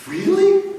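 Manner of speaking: high, amazed really; a stunned, delighted squeak. Retold or imitated. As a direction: partner thrilled at the news. Chinese partner amazed.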